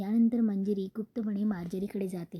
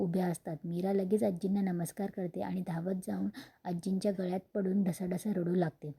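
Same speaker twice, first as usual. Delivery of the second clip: ordinary delivery, nothing marked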